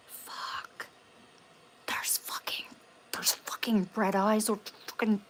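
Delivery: acted-out frightened whisper, halting and stammered; breathless mock-terror whisper; panicked whisper. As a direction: whisper it like you've just spotted something terrifying. Acting out scare at red eyes.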